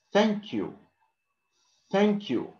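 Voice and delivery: "thank you" repeated high and deliberate, clear th; teacher's careful "thank you", tongue between teeth; blown th. Shown as a demonstration. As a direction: blow the th at the start of "thank you". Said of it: "you blow and make that sound".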